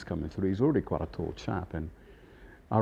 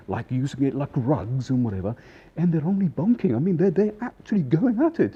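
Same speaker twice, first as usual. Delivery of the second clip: rising higher and louder, breathless scandalized gossip; excited gossip; 7 semitones higher, 6 dB louder than usual. Retold or imitated. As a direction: spill the scandal, building to a squeal. Colleague bursting in with gossip.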